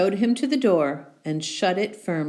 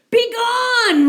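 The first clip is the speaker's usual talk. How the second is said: much higher, louder roar; roaring bear; 9 dB louder than usual. Acted. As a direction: bear roars at the mouse. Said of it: "roared the bear".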